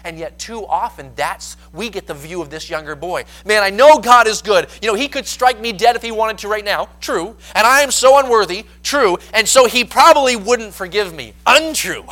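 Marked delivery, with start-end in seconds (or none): 3.4-6.9 put-on loud, higher, anxious young man; voicing the frightened son; the prodigal son's inner voice. Voicing the younger boy's thoughts. 7.5-8.7 put-on loud, higher, anxious young man; voicing the frightened son; the prodigal son's inner voice. Voicing the younger boy's thoughts. 9.3-11.3 put-on loud, higher, anxious young man; voicing the frightened son; the prodigal son's inner voice. Voicing the younger boy's thoughts.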